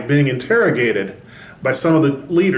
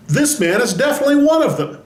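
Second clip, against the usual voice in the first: put-on accusing servant girl; 10 semitones higher, faster than usual. Servant girl accusing Peter, read aloud.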